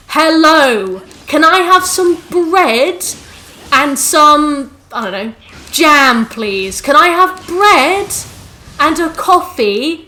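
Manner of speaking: loud, high, very slow tourist English; over-enunciate for locals, mockingly. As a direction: parents ordering in a French cafe, mock-slow English. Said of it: "Speaking English really slowly".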